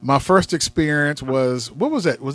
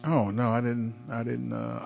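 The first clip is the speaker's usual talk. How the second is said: normal talking voice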